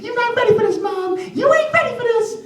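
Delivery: put-on high cocky child voice; high swaggering boy; boastful kid. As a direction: brag to mom before playing.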